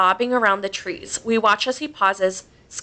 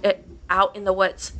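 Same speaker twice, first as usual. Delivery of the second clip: usual speaking voice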